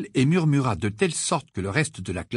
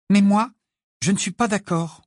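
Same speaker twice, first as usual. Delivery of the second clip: put-on firm young girl, a bit higher; Hermione voice; 8 semitones higher, 5 dB louder than usual. A narrator's reading. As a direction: stand your ground, politely. (voix de jeune fille, ferme)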